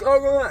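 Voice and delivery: high, loud imitated moans; moaning. Thirsty patient in the hospital bed, moaning. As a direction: mimic a suffering patient's moans. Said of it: "he was moaning and crying".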